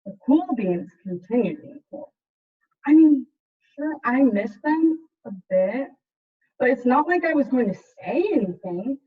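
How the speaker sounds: put-on lively picture-book narration for kids; story reading. A narrator's reading. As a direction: read it like a storyteller to a class. Teacher reads picture book aloud.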